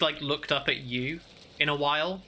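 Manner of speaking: ordinary delivery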